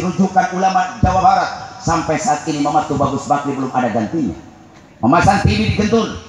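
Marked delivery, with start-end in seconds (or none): 5.0-6.2 chanted praise climbing higher line by line; sing-song preaching. Acted. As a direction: lift each repeat a step.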